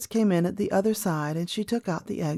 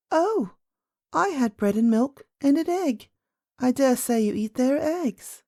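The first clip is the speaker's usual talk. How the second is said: put-on high, chatty little-girl voice; a young princess eagerly answering; 5 semitones higher than usual. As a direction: play a child, pitched up. Princess answers old lady.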